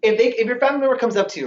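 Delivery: usual speaking voice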